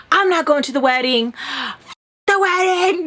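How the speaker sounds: mock outrage jumping high at the end; retold mad. Refusing to go to the wedding, mad.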